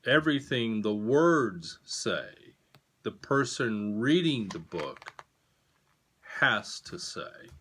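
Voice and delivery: slow, deliberate storybook read; reading to children. A narrator's reading. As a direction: read it to kids, slow and clear. Reading picture book aloud.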